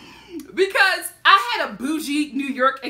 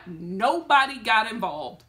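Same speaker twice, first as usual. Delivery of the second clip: slower, settled restatement; slow, measured emphasis; slower than usual. Real, not acted. Storyteller driving the point home, slow and measured. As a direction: spell it out slowly at normal volume.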